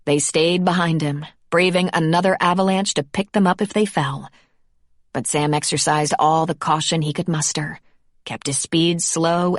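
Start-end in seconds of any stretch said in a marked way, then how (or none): none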